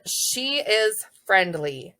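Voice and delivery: stretched out slowly. Shown as a demonstration. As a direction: drag each word out. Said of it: "and then".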